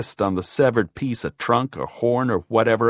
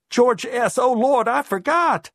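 very high, louder flustered cry; panicked realization; 14 semitones higher, 4 dB louder than usual. A narrator's reading. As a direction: blurt it out in high-pitched alarm. She panics at what she forgot.

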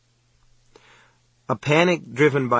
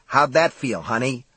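usual speaking voice

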